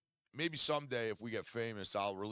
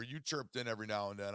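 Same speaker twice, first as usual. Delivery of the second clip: ordinary delivery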